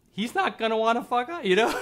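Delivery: high squeaky voice breaking into a laugh; laughing high at your own point; amused, high-pitched. Real, not acted. Joking about the texted person's view.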